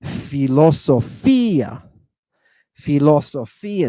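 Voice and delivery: "philosophia" as fee-lo-so-FEE-ah, stressed on FEE; slow Greek "philosophia" with a long FEE; accent on iota. Shown as a demonstration. Teacher modelling the accent, "philosophia" as fee-lo-so-FEE-ah. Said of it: "we put the accent over the iota".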